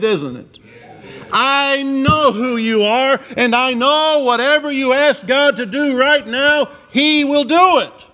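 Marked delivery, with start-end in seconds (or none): 1.3-8.1 high, drawn-out declaration of faith; confident faith. Retold or imitated. Preacher voicing Martha's faith to Jesus, confidently declaring. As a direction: proclaim it with ringing certainty, stretching the words. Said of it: "That's a great statement of faith".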